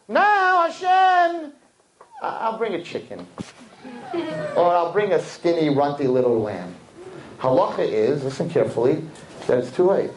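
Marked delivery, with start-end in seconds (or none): 0.1-6.8 sheepish bargaining. Retold or imitated. Man bargaining with God.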